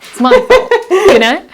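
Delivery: high, loud, laughing self-blame; squealing with laughter at the joke; laughing punchline. Pretend. Mock cheerful blame-taking punchline.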